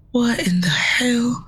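hushed, horrified whisper; a stunned, hushed what in the hell; whispered shock. Acted. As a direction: breathe out the horror in a whisper. Player reacts to bloody hands.

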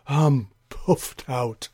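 puffing, slow and breathless; out of breath. Acted. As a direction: gasp it out, winded. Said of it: "said the wind".

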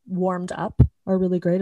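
usual speaking voice

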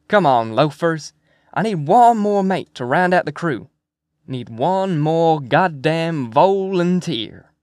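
loud, high call to round up a crew; rallying call. Acted. Rallying men to join the crew.

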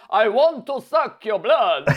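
slow, hammed-up Transylvanian vampire; menacing cartoon vampire, slowly; Dracula. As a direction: do a mock vampire accent, drawn out. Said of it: "One of the prime ones that's listed is Dracula".

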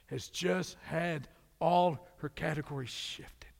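breathy hushed whisper. Acted. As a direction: lean in and whisper it. Whispered climax.

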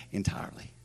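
breathy whisper of one word. Real, not acted. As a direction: whisper it to land the point.

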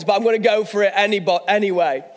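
normal talking voice

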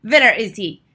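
loud, sharp demand; sudden angry witch bark; snapping witch. A narrator's reading. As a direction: snap it out, hunting your quarry. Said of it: "snapped the Grand High Witch".

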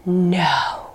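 hushed, unvoiced whisper. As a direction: lawyer whispering, overcome with emotion. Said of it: "and he just goes".

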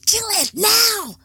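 frantic creature shout; shouted order. Acted. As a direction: scream kill it now.

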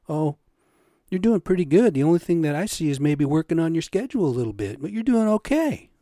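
a sarcastic impression of the boss being nice; mocking boss voice. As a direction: parrot the boss's fake praise. Boss's reply retold mockingly.

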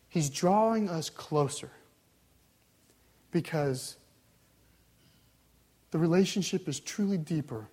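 ordinary talk

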